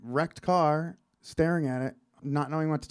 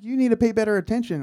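quick, high, louder telling-off; retold scolding; 8 semitones higher, 6 dB louder, faster than usual. Officer scolding the young driver after the crash, reproving. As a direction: scold him in a rapid, raised voice.

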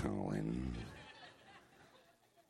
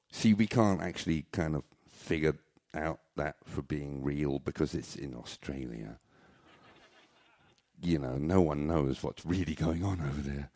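ordinary talk, nothing marked

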